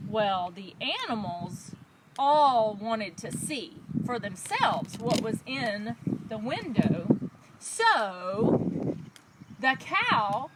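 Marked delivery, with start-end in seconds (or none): none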